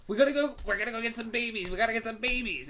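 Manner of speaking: high, fast manic jabber; Vince Vaughn. Retold or imitated. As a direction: rattle it off like a hyper party guy. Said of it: "the most annoying characters".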